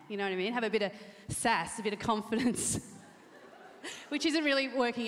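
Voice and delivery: normal talking voice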